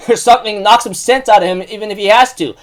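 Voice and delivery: usual speaking voice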